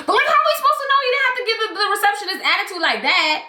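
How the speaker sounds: mock indignant squeal. Retelling her reaction to the girl, high fast mock outrage. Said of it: "I was like".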